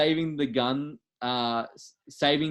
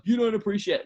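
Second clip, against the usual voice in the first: higher, mock-indignant protest; indignant haters; 9 semitones higher, 3 dB louder than usual. Podcaster imitating haters who object to nitpicking an old movie, mock-indignant. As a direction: imitate complaining haters, pitched up and louder. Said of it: "get some haters come back and be like".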